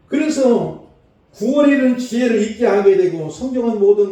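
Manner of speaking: high, loud scripture recitation; full-force preaching. Acted. Preacher declaiming scripture to the congregation, high and forceful. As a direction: proclaim the verse in a sustained high, ringing declamation.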